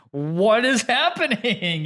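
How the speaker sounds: high, drawn-out baffled exclamation; mock bewilderment. Podcaster retelling finding Arcane on a soccer subreddit, mock bewilderment. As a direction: stretch it out in amused disbelief. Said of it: "I was like".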